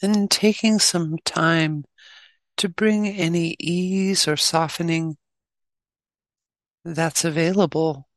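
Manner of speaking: measured meditation guide with long pauses; unhurried instructions, leaving long silences; guided meditation. Acted. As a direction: guide them gently into stillness, phrase by phrase.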